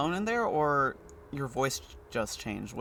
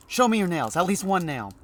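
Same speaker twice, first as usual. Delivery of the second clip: high, loud demand; insist, a little unhinged; demanding man behind door; 7 semitones higher, 8 dB louder than usual. Acted. Man behind the door loudly demanding to see the doctor's nail.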